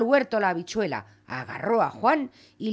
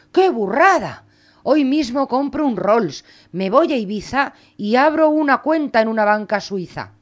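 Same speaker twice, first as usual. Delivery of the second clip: louder, higher, gleeful at sudden riches; excited mother; 3 semitones higher, 7 dB louder than usual. Acted. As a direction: burst out greedy with joy. Mother thrilled by gold.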